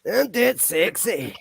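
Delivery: high, slow Scottish-accented brag, hammed up; Fat Bastard voice. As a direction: purr it slowly like a smug glutton. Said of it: "Austin Powers, that fat bastard".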